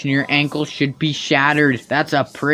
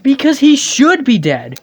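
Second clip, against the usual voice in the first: higher, slow and emphatic, fed up; exasperated outburst; 11 semitones higher, 5 dB louder than usual. Real, not acted. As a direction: spell out the absurdity, pitched up. Mocking the game's logic.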